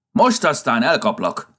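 angry shout. A narrator's reading. Santa shouting angrily at a runaway rabbit. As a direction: threaten to catch him, shouting. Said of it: "kiáltotta".

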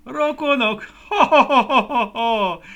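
high, loud, shaking laughter; belly laughing. Acted. Plums laugh at Guszti, high loud guffaws. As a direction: laugh it out, big round plums. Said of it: "rázkódott a nevetéstől".

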